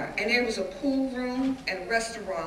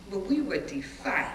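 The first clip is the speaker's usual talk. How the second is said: normal talking voice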